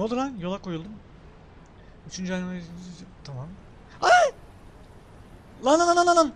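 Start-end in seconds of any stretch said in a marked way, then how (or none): 4.0-4.3 sudden high yelp of surprise; real startled shout; startled cry. Real, not acted. Sudden scare in game. 5.6-6.3 loud, high, rapid excited shouts; real frantic yelling; frantic shouting. Real, not acted. Chasing something in game.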